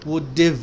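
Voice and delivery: Would div, have shrunk onto the d of Would; linked words. Shown as a demonstration. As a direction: say Would div as one joined word. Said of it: "ایسے connect کرنا ہے".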